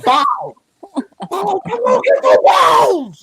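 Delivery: high, loud retold shout with drawn-out words; mock yelling. Man retelling the outburst he laughed at, mock yelling. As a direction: yell it out, stretching the last word.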